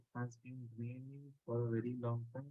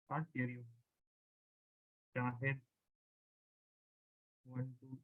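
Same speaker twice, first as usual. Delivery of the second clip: very faint, low-level voice; quiet and hard to hear; barely audible; 6 dB quieter than usual. Real, not acted. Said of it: "Sir, your voice is very low".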